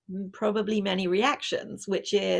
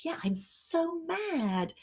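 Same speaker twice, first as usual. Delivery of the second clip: higher, softer, slow sulking daughter; sulky teen; 9 semitones higher, 6 dB quieter, much slower than usual. Retold or imitated. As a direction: mope it out like a grumpy teenager. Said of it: "let's say she does".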